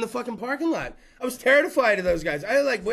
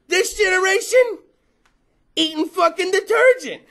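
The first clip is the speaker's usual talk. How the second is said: usual speaking voice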